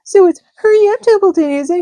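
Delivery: high, loud mocking imitation of the pig; mocking Wilbur. A narrator's reading. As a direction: mimic the pig's pleading. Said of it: "He began imitating Wilbur's voice".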